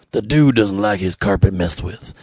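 a lazy, lightly done Big Lebowski voice; bad Dude impression. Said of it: "It's a very bad impression".

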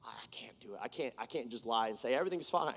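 low, hushed admission of defeat; soft recreation of breaking down, understated; retold bawling. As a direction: falter quietly as if holding back sobs. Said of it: "I just start bawling".

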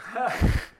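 high, loud laugh bursting out; loud burst of laughter. Real, not acted. Admitting he still stuffs up, loud high laugh. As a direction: laugh out big at himself.

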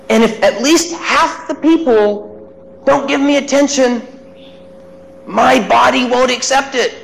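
put-on high child voice, earnest; child's explanation. Son explains to dad, high earnest child voice. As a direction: play the boy working it out.

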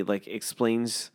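usual speaking voice

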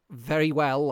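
curt. A narrator's reading. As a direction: answer shortly, a bit impatient. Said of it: "I answered shortly".

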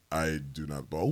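low-key, deadpan reply; deadpan denial. Acted. His deadpan answer to stranger.

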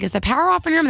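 worried mom voice. Retold or imitated. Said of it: "she's like".

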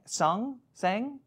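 "sung" and "sang" each lifted high like a question; unsure questioning. Real, not acted. Preacher unsure whether it is sang or sung, questioning. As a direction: try out "sung", then "sang", with rising pitch. Unsure which word is correct.

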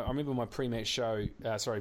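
ordinary talk

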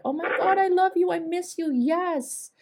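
high, excited mock gush; imitating a friend's high, thrilled reply; imitated gushing friend. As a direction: squeal it like a delighted old friend. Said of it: "she'd be like".

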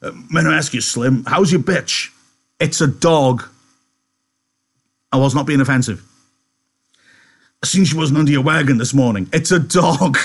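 high, booming ranch-hand voice; loud, jovial big man. Acted. Big man asking Slim about his dog, loud and hearty.

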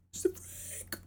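hushed, breathy aside; whispered aside. Real, not acted. Telling cohost Sharon is a freak, whispered. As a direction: breathe it out in mock shock.

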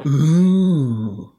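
loud, long swooping "ooh"; mock-impressed ooh. Host reacting to guest's Vancouver fellowship, with a loud mock-impressed ooh. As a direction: draw out a big, high ooh.

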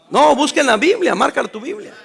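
ordinary delivery

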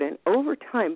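ordinary talk

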